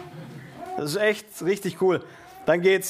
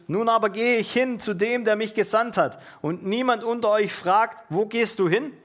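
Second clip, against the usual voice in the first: higher-pitched, declaimed verse; scripture reading; 4 semitones higher than usual. Acted. Preacher reading Jesus' farewell to the disciples, declaimed. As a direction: read it out clearly from the Bible.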